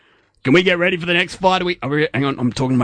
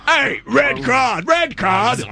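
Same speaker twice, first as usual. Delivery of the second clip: referee shout; 9 semitones higher, slower than usual. Acted. Referee calling foul.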